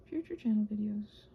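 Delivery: ordinary talk, nothing marked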